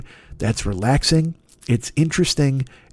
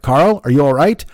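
louder, put-on dramatic voice-over; mock concerned announcer; 5 dB louder than usual. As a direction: fake TV concern, hammed up. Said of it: "and they're like".

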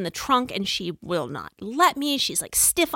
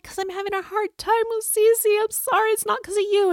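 teary apology; 11 semitones higher than usual. Retold or imitated. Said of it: "We're all crying in the car".

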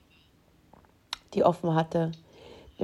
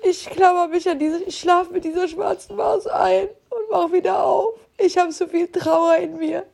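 crying; 7 semitones higher than usual. Real, not acted. Grieving over her dead cat.

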